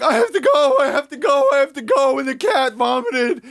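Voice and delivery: loud, high-pitched hysterical wail; hammed-up uncontrollable sobbing; mock sobbing. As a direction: imitate a dramatic roommate wailing in panic. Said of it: "she's, like, sobbing".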